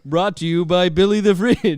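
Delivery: loud high announcer read. Pretend. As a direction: boom the sponsor line. Fake sponsor announcement.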